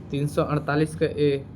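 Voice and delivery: ordinary talk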